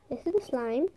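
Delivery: soft breathy asmr voice; hushed asmr slime intro; asmr whisper. Acted. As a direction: whisper it for the microphone. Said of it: "Let's do some A S M R".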